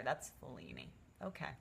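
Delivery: ordinary talk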